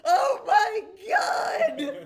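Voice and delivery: shrill panicked goblin shriek, slow and drawn out. Acted. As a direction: scream it in total panic, laughing. Goblin freaking out.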